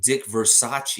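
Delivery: Versace said like the fashion house, ver-SAH-chee; wrong pronunciation. Shown as a demonstration. Host airing viewers' way of saying the coach's name, mispronounced. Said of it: "It's not pronounced Versace".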